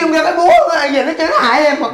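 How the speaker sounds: high, loud complaint; indignant protest. Acted. Singer complains to manager of being tricked, indignant.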